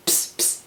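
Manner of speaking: hissed attention-getting psst; voiceless conspiratorial psst; secretive psst. Retold or imitated. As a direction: summon someone on the sly. Tranio secretly summons Lucentio.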